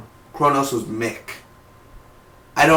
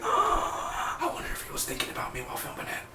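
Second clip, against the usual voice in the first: whispered fan daydream, much softer; dreamy whisper; 15 dB quieter than usual. Retold or imitated. Fan whispering to himself about the actor. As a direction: breathe it like a secret wish.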